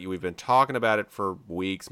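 ordinary delivery

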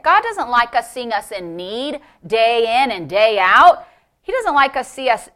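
usual speaking voice

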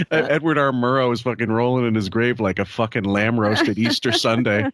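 ordinary delivery

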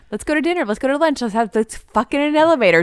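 high-pitched mock excited rattle of invitations; mock-tipsy escalation, pitched well up; escalating, uninhibited babble. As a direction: toss out suggestions high and giddy, imitating her. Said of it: "She's getting louder and louder".

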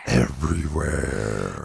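low, slow drawn-out word; deep slow drawl. Pretend. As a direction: drop low and stretch it.

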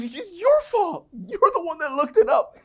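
hammed-up whiny friend shifting the blame. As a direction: play the defensive buddy pointing fingers. [name] blames him back.

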